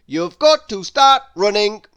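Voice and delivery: bossy Bustle. A narrator's reading. Mister Bustle loudly warning Lazy about the whistle. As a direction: lay down the rules firmly. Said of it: "said Mister Bustle".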